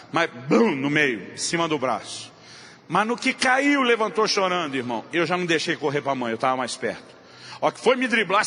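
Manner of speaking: usual speaking voice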